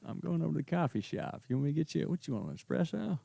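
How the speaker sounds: offering coffee to his ex in a drawling movie-star voice; Matthew McConaughey impression. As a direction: sweet-talk like a laid-back movie-star cowboy. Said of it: "good Matthew McConaughey impression".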